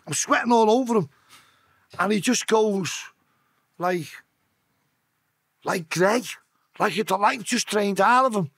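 ordinary talk

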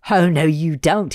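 slow, loud scolding; chiding. Acted. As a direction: chide her like a brat. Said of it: "chiding her".

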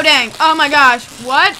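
ordinary delivery

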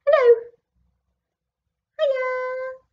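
put-on tiny high baby dragon. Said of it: "said the baby dragon".

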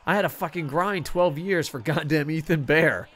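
usual speaking voice

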